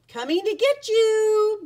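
big, high, loud call; booming. A narrator's reading. Boris the bear seeking his classmates, booming. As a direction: boom it out across a game of hide and seek. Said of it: "Boris boomed".

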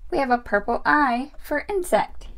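plain reading voice

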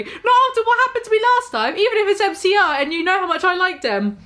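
high, fast indignant rant; angry shout. A narrator's reading. Enderby refusing Draco, fast high angry rant. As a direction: pile on the outrage high and quick. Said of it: "I shouted angrily".

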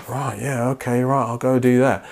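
soft, offhand mock agreement; meek compliance. Person taking orders from sin, meekly compliant. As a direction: go along with it quietly, no resistance.

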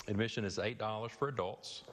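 ordinary talk, nothing marked

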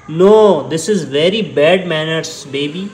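very slow drawn out scolding; mock stern scolding. Real, not acted. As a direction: draw out a stern word to the kitten. Scolding kitten slowly. (धीमी खिंची हुई डांट)

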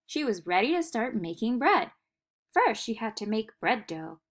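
usual speaking voice

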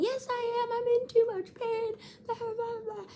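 high squealing imitation of a patient; mock yelling. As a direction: wail back in a put-on high voice. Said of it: "yelling at the nurses".

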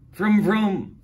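loud, high imitated engine roar; engine vroom. A narrator's reading. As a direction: rev it like a bus starting up.

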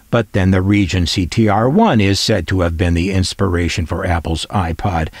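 ordinary talk

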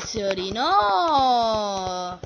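ordinary talk, nothing marked

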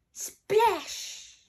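high, loud splash; big storybook sound effect; exclaimed splash. Acted. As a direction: burst out with the sound word. Sound word acted in story.

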